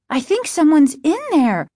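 high, loud alarmed exclamation; exclaiming. A narrator's reading. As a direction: blurt out the startling realization. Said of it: "Margaret exclaimed".

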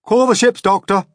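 high, loud call for help; officer calls for the doctor; urgent command. A narrator's reading. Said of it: "the first officer said".

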